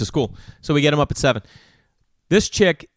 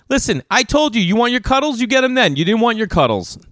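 aggressive; 9 semitones higher than usual. Retold or imitated. Parent scolding stubborn daughter about cuddles, aggressive. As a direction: snap the same sweet words at her. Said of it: "like, aggressive to her".

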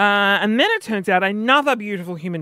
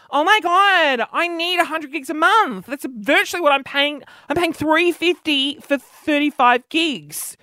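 much higher, gushing; thrilled; 7 semitones higher than usual. Retold or imitated. Customer hearing about a cheap data plan, thrilled gush. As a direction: gush like you've won the lottery.

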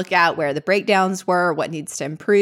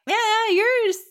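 mock insincere reaction; slower than usual. Imitating reaction to insincere thanks.

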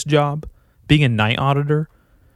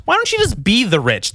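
normal talking voice

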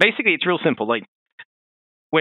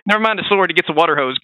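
ordinary delivery

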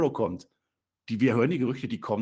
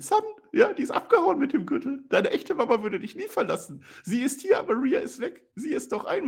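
high falsetto Rey, louder at the start; radio play voice; 4 dB louder than usual. Acted. Narrator voicing Rey taunting Dominik, high falsetto. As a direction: play the masked uncle in a squeaky falsetto. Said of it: "wir machen ein Hörspiel".

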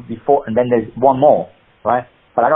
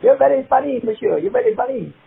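put-on high-pitched French accent; amused old Frenchman, voice well up; French chauffeur impression; 10 semitones higher than usual. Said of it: "He was doing the impression of his chauffeur".

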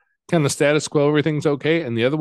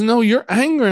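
put-on high, louder child insisting; insistent child; 12 semitones higher, 4 dB louder than usual. Small boy insisting to his foster dad, high and louder. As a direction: play the kid who won't back down.

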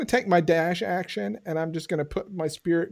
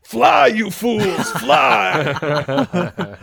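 dramatic shout; 4 semitones higher, 9 dB louder, much slower than usual. Acted. Gandalf quote in-game.

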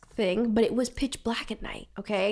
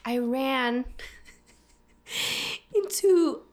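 slow, halting, questioning rise; retold confusion; 3 semitones higher, much slower than usual. Confused girl calling her mom, halting mock excuse. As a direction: imitate a dazed, unsure excuse with a laugh. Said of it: "And in my confusion".

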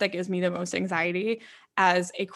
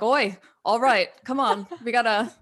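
mock British accent; 3 semitones higher, 3 dB louder than usual. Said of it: "I now speak in an accent to Rob".

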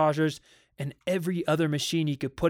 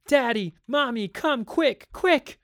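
high-pitched urgent calls, rising higher; children calling; 9 semitones higher, 4 dB louder than usual. A narrator's reading. Children summoning their parents, urgent calling. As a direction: call out like a child, climbing in pitch. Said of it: "they heard the children calling".

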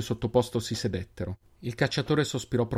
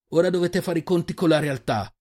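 impassioned accusation; 7 semitones higher than usual. Acted. Accusing speech to the captors.

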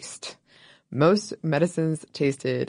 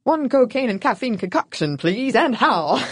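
higher-pitched, hammed-up old-time ordering voice; mock soda-counter customer; 5 semitones higher than usual. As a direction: place a playful order, drawing out the ending. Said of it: "Your order might go something like this".

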